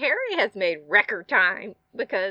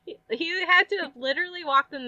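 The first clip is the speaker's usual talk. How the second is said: incredulous; 8 semitones higher than usual. Retold or imitated. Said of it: "I'm like".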